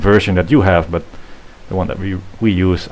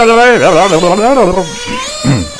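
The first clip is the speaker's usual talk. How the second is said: high squeaky throat clear; 13 semitones higher, 6 dB louder than usual. Pretend. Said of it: "my chipmunk voice".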